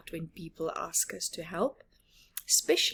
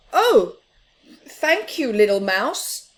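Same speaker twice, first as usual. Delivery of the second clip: delighted thanks; 3 semitones higher, 10 dB louder than usual. Acted. Big Mouse thanking Little Mouse for the sun hat, delighted. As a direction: sound touched and pleased.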